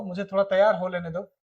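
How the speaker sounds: ordinary talk, nothing marked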